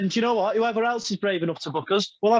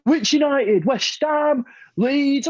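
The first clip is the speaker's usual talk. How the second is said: higher, louder mock fan taunting; mocking Arsenal fans; 7 semitones higher, 4 dB louder, much slower than usual. Retelling the Arsenal fans at the bar, higher mocking taunt. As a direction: sneer it up like a rival fan. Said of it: "And they went".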